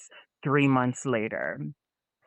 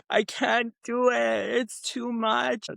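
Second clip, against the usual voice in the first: high, slow mock sobbing plea; imitating crying; 8 semitones higher, slower than usual. To her mom from the fishing boat, high, slow, mock crying. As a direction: whimper it like a tearful kid on the phone. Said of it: "I'm like".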